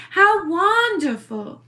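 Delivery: put-on slow, drawn-out East Hampton accent; Little Edie. Explaining her quarantine study, in a slow East Hampton accent. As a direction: luxuriate in an affected East Hampton socialite accent. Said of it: "talking like I was Edith".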